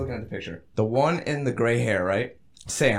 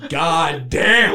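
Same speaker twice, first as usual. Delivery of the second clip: loud, drawn-out disbelief; retold amazement; 7 dB louder than usual. Telling friends about triple-checking the girls, amazed. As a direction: act out being floored, louder.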